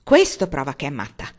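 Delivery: low, loud gruff grunt. A narrator's reading. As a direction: grumble it with certainty. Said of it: "grugnì lo zio Benjamin". (grugnito brusco)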